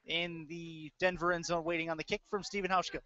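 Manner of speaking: ordinary talk, nothing marked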